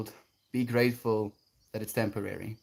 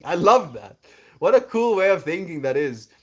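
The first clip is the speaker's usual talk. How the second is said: excited; 6 semitones higher, 7 dB louder than usual. Real, not acted. Excited praise.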